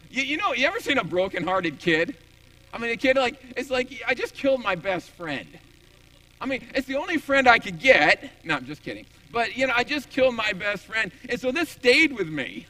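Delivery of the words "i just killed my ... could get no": normal talking voice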